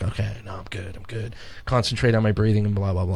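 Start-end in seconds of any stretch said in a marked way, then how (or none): 0.0-1.4 a soft murmur, talking himself down; quiet self-calming. Retold or imitated. Retelling calming himself inside the costume, murmuring softly. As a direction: hush yourself into being okay.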